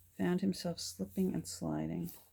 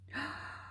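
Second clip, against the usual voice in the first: soft, high intake of breath; amazed gasp; 8 semitones higher, 8 dB quieter than usual. Real, not acted. Crafter opens an old album of stickers, amazed gasp. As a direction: gasp quietly in wonder.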